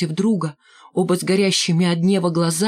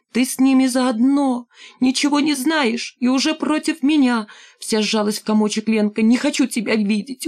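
high, raging accusation; girl turning on her grandfather in fury; furious outburst; 5 semitones higher than usual. Acted. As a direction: hurl it at him, wounded.

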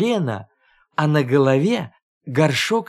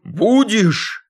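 higher-pitched angry demand; raised-voice bear-cub challenge; shouting; 5 semitones higher than usual. A narrator's reading. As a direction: snap out a threatening question. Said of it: "кричал он".

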